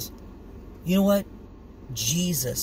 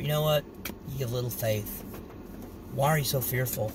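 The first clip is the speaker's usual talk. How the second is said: 1 soft voice of the Lord; 3 semitones lower, 6 dB quieter, faster than usual. Acted. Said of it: "the Lord will say in your spirit".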